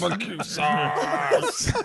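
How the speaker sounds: slow laughing death rattle; mock dying gasp. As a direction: fade out like a dying man.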